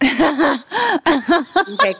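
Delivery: laughing, high; laughing okay. Real, not acted. Laughing, wants topic closed.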